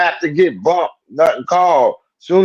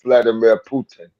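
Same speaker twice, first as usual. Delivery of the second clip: put-on Russian accent; 6 semitones lower than usual. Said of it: "that's your Russian accent".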